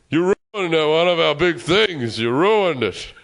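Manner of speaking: slow, indignant telling-off, imitating a star. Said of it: "he's a bit angry".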